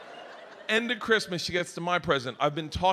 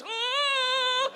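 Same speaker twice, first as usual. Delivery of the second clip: higher, drawn-out gasp; mock shriek. Daughter smelling the fart, high gasp. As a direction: gasp like a betrayed child.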